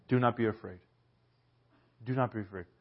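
soft, hushed comfort; gently calm a terrified man; tender, quiet "do not be afraid"; reassuring. Retold or imitated. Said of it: "what does this Son of Man do".

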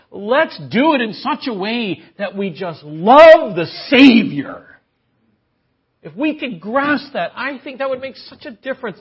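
high, loud impassioned preaching; fervent preacher pleading with the saints; preaching at full force. Acted. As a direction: lift the congregation, voice soaring.

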